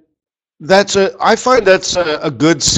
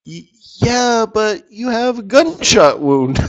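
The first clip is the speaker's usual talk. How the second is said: higher, slow, lightly voiced doctor in disbelief; incredulous doctor; 8 semitones higher than usual. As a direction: play a lightly voiced doctor pointing out the obvious. Doctor in joke answers patient.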